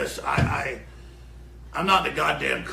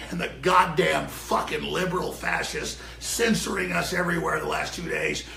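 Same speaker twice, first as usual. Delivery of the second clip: normal talking voice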